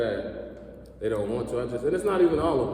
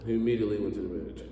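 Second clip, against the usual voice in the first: ordinary talk, nothing marked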